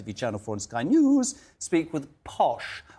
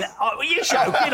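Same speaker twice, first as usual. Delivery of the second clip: laughing mock disbelief; 11 semitones higher, 5 dB louder than usual. Host mocking the guest's posh voice.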